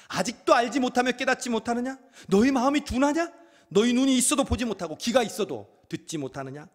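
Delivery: high, loud rebuking questions; angry Jesus. Acted. Jesus rebuking his disciples, angry loud questions. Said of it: "그만큼 예수님이 화가 난 거예요".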